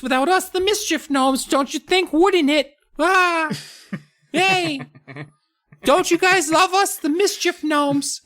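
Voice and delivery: tiny gnome proud of mischief; mischievous gnome voice. Acted. Gnomes bragging to the kids about mischief, very high.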